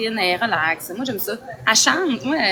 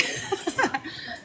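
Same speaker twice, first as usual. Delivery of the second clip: breathy giggle; 6 semitones higher, 10 dB quieter than usual. Real, not acted. Excited airport greeting.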